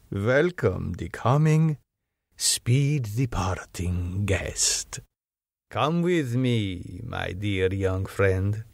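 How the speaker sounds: silky menace under polite words; sweet courtesy. Acted. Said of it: "said with a sweet courtesy".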